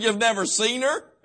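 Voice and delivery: laughing. Real, not acted. Laughing at missionary's arranged marriage.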